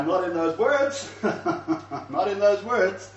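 laughing admission; amused, laughing. Real, not acted. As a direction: chuckle through the correction. Laughs at his own loose paraphrase.

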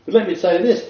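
normal talking voice

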